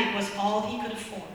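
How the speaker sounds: normal talking voice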